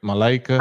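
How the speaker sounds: Malaika with a LAY middle syllable; name as asked. Shown as a demonstration. Host asks woman if her name is right, saying Malaika with LAY. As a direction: say Malaika as "muh-LAY-kuh". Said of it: "Am I pronouncing your name correct".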